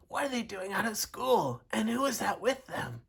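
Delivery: high, scratchy teacher's voice. A narrator's reading. Teacher with sore throat spotting her pupils, scratchy. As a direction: question it through a sore throat. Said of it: "she said in a scratchy voice".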